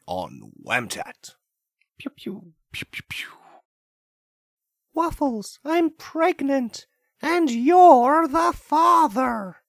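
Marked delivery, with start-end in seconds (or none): 2.0-3.3 soft whispered laser pew; whispered pew sounds. Acted. Sound effect intro. 4.9-9.6 very high, slow melodramatic cry; high dramatic voice. Acted. Soap opera dramatic line.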